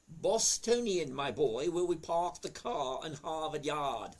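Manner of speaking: "Harvard Yard" with dropped r's as "Hahvahd Yahd"; Boston accent. Pretend. To a chat viewer, showing off his Boston accent. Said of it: "is that a northern accent?".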